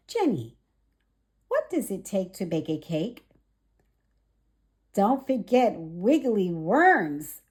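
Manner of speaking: lively storybook read-aloud; gentle children's story narration. A narrator's reading. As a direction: read it bright and clear for little ones. Reads picture book to children.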